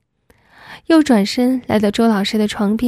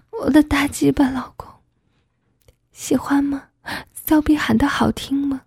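higher, breathy wife's voice; hushed, higher intimate murmur; lightly voiced wife; 4 semitones higher than usual. A narrator's reading.

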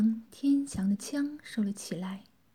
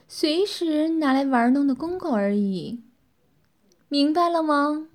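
higher, louder mistress voice; smiling dominance; 5 semitones higher, 5 dB louder than usual. A narrator's reading. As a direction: lord it over him with a smile. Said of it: "微笑道".

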